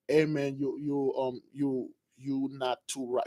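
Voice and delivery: low, very soft voice; quietly acting out a doubter; hushed wary aside. People telling a voice-hearer he is not right, hushed and low.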